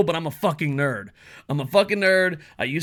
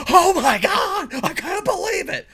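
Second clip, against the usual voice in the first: over the top excited; 5 dB louder than usual. Pretend. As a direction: parody a creator faking amazement. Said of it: "over the top excited".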